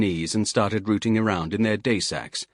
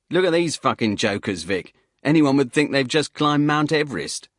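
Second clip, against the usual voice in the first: louder, scoffing soldier's jeer; mocking laugh at the jokers; 6 semitones higher, 5 dB louder than usual. Soldier mocks the ramblers to his mate, louder, scoffing.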